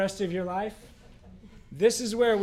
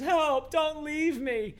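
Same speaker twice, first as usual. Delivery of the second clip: pleading; 8 semitones higher, slower than usual. Retold or imitated. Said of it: "and he says".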